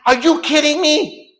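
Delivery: very high, loud, drawn-out protest; outraged disbelief. Retold or imitated. American answering corrupt cops' demand, outraged high yell. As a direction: shout it slowly in sheer incredulity.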